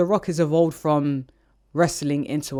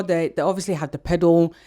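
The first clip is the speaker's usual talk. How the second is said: ordinary talk, nothing marked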